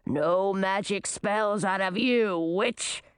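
slow, low monster voice; menacing manticore. A narrator's reading. Manticore covers the witch's mouth, slow menacing warning. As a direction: warn her off, taking your time.

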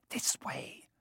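breathless unvoiced whisper; soft secret whisper to friends; mouthed whisper. A narrator's reading. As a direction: barely breathe the words so no one hears. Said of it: "Harry mouthed".

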